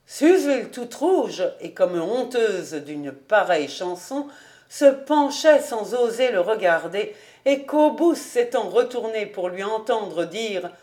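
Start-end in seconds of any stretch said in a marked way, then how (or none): none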